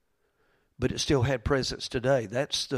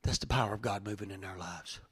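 hushed; 4 semitones lower, 10 dB quieter than usual. Real, not acted. Soft reverent close.

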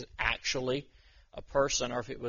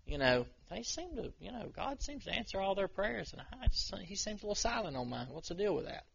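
higher, softer mock grumble; a quiet, high-pitched gripe, as the slighted servant; mildly offended; 7 semitones higher, 6 dB quieter than usual. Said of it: "this servant was absolutely one hundred percent offended".

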